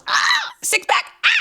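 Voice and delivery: high squealing cry of delight; swooning squeal. Real, not acted. Fan gushing over the hero's body, swooning squeal. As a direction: squeal like you just saw the abs.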